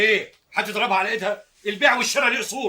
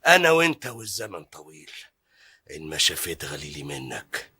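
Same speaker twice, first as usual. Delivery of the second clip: much lower and slow, seething; low, slow threatening grudge; menacing vow of revenge; 12 semitones lower, slower than usual. Acted. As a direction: promise revenge under the breath. Vowing revenge alone.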